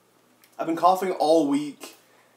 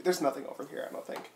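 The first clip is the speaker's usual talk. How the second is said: softened to a near-whisper; real hushed late-night voice; 10 dB quieter, much faster than usual. Real, not acted. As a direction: keep it down so nobody wakes. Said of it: "You're gonna wake up your parents".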